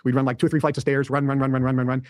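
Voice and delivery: ordinary delivery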